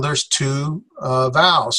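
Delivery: ordinary delivery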